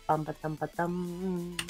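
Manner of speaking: ordinary talk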